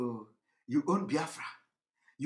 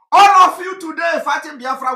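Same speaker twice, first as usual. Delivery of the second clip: angry shout; 7 semitones higher, 10 dB louder than usual. Real, not acted. Angry ranting accusation.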